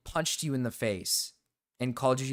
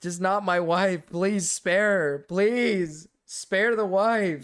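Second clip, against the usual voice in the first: slow, high, drawn-out begging; mock pleading; 5 semitones higher, 5 dB louder, much slower than usual. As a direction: beg dramatically for mercy. Mock begging to spare his wife.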